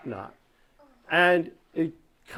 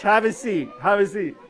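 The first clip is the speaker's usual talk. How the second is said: ordinary talk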